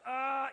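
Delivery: loud mock cry of pain; pained groan. Pained grunt in therapy.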